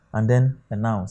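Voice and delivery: normal talking voice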